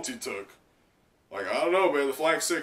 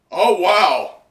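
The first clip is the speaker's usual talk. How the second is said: loud high oh wow; surprised wow; 6 semitones higher, 11 dB louder than usual. Real, not acted. Reader reacts to the page with a loud, high oh wow. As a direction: burst out in surprise.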